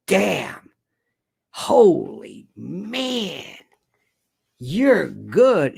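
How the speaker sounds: lightly voiced Putin, slow and awed; a hint of an impressed Russian leader; mock Putin voice. Putin on the phone, awed by Trump: mild mock Russian. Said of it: "Vlad is thinking".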